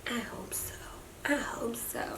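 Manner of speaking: soft unvoiced whisper. Real, not acted. Mother whispers to her baby.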